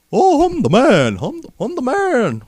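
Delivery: bad Irish accent. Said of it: "I can't do an Irish accent".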